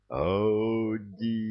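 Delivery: booming narrated god's shout; deafening cry. A narrator's reading. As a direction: call out to the sky, stretching the word long. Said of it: "опять он оглушительно закричал".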